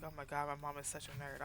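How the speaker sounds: low, soft mock-exasperated aside; teasing imitation of son. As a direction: drop low and quiet, imitating an embarrassed kid. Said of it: "he was just like".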